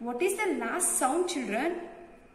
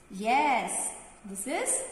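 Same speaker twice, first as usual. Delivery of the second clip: usual speaking voice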